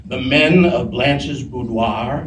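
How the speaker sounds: slow, lightly voiced co-star's line reading; B. Arthur. Co-star reads the gift aloud in the scene, slow lightly imitated delivery. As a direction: draw the line out slowly, with a hint of impression. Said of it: "B gives me the line again".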